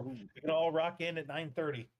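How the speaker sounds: ordinary talk, nothing marked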